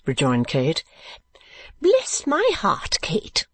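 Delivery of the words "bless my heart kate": high, drawn-out cry of surprise; sudden exclamation. A narrator's reading. Mother suddenly exclaiming to her daughter, high exclamation. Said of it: "cried Missus Nickleby with her wonted suddenness".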